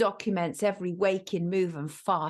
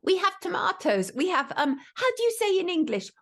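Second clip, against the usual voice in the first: higher-pitched mock Spanish accent; pronounced Spanish accent; 10 semitones higher than usual. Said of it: "in a pronounced Spanish accent".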